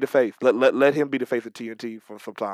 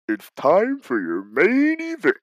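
drawn-out main event announcement; big slow announcer call; Mark Henry; 6 semitones higher, 6 dB louder, slower than usual. Acted. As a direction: stretch it out high and loud like a hype man. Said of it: "Mark Henry doing this main event".

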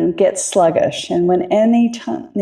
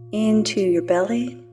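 calm grounding exercise. Acted. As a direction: ease them gently into their bodies, unhurried. Leads a grounding meditation.

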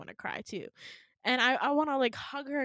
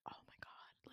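barely audible whispered disbelief; stunned whisper; 27 dB quieter than usual. Retold or imitated. Stunned muttering to herself, retold.

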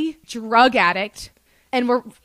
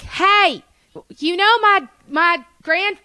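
high, loud imitation of an entitled Southerner; mock a Southern boy threatening the cops; hammed-up indignant Southern brat; privileged Southern boy; 8 semitones higher, 4 dB louder, much slower than usual.

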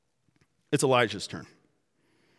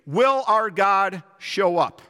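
slow, raised plea to an absent god; pleading; 4 semitones higher, 4 dB louder, slower than usual. Acted. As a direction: plead out loud, drawing it out. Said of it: "and they're pleading".

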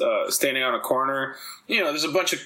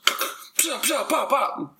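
breathy then popping gunshot noises, pitched up; imitated gunshots; 10 semitones higher, much slower than usual. Telling how the cop fired, mouth gunshot noises. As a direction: spit out gunshot sounds with the lips.

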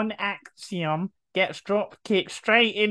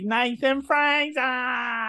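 drawn-out high ring announcer call; 6 semitones higher, slower than usual. Pretend. Announcing the wrestler.

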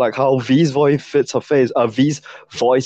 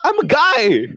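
high-pitched, incredulous protest; indignant disbelief; much slower than usual. Real, not acted. Guy objecting to being cast as a girl, indignant.

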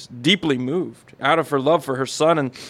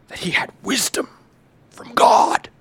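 dramatic finale; 13 semitones higher than usual. Acted. Grand ending of dramatic reading.

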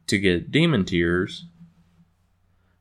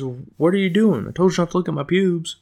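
high-pitched mock scolding; quick, pitched-up put-on protest; scolding farm girl; 10 semitones higher, 3 dB louder than usual. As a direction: imitate an indignant farm girl telling him off. Voices farm girl scolding Gary.

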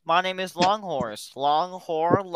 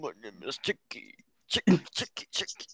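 lower, quieter rumbling chant; deepest voice; 8 semitones lower, 5 dB quieter than usual. Pretend. Friend dares [name] to do his deepest voice. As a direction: sink into his deepest voice for the rhyme. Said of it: "do the deepest voice you can".

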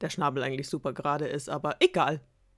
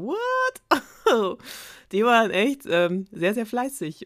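amazed; 6 semitones higher, 6 dB louder than usual. Real, not acted. Amazed at how productive they were.

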